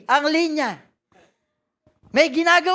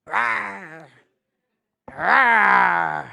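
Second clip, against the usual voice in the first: low growling mock roar; demon roar; 8 semitones lower than usual. Preacher imitating the demon shouting at people, low growl. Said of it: "bakit sumisigaw yung demonyo".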